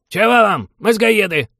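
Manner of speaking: put-on loud, very high old-man holler; high, strained shout of a hostile grandpa; crotchety old guard. A narrator's reading. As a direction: yell back at intruders as a cranky old sentry. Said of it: "охотно откликнулся караульный".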